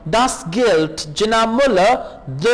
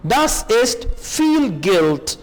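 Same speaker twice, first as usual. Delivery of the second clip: slow model reading; much slower than usual. Shown as a demonstration. Slow German model phrase.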